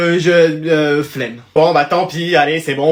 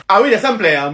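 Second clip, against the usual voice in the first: ordinary talk, nothing marked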